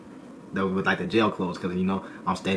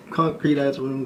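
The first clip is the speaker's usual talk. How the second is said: normal talking voice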